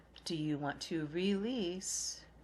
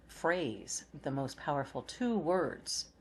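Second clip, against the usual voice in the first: normal talking voice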